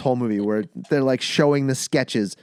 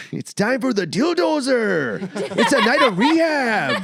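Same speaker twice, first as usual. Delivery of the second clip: higher, hyped announcer voice; monster truck ad; 10 semitones higher, 5 dB louder than usual. Retold or imitated. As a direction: boom it like an arena announcer. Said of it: "monster truck announcer".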